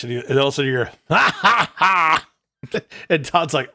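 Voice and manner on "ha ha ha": loud, high, forced "ha ha ha"; mock laugh. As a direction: imitate a big booming burst of laughter. Imitates laugh in retold story.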